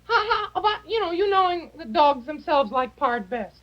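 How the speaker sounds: high falsetto lady voice, fluttery; a high woman's voice gushing over the food. Acted. Falsetto character urging.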